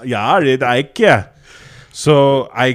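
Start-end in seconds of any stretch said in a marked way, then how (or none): 0.6-1.3 "ikea" loud, first syllable punched; emphatic correction. Real, not acted. Insisting to a friend on the store name, stressed "ikea". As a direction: hit the "I" at the start of "ikea". Repeats the misheard word emphatically.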